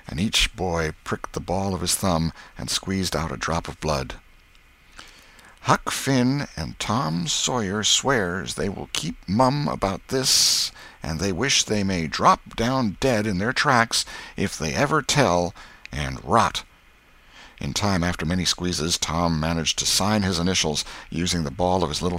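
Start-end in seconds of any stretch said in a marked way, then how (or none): 5.6-16.6 put-on slow, ceremonious boy's oath; solemn oath reading. Huck and Tom swear silence, slow solemn reading. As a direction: intone each phrase slowly, sinking to the end. Boys' written oath read out.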